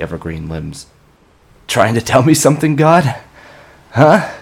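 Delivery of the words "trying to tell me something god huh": high, loud agitated yelling; shouting at crows. Acted. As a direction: shoo the birds off angrily. Shouting at crows, waving arms.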